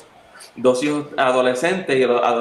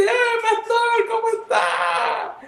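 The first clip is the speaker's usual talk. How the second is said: high, slow, hammed-up call; mock shouted greeting; slower than usual. Elder spots the pastor in a tree, slow high call. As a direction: stretch the greeting out across the field.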